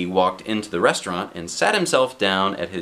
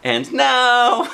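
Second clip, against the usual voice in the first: higher, louder burst, launching back in; chatterbox resuming; 14 semitones higher, 6 dB louder than usual. Acted. Miss Chatterbox starts up again as the bank closes, higher and louder. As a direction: pop in high and bright to start talking again. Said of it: "continued Little Miss Chatterbox".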